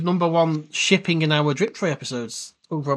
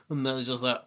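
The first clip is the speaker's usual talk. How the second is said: ordinary delivery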